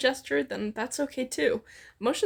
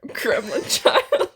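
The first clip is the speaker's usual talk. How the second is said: breaking into laughter on the last words; cracking up while reading it out; real giggle through the words; laughing; 3 semitones higher, 6 dB louder, much slower than usual. Real, not acted. Laughing mid-sentence while reading.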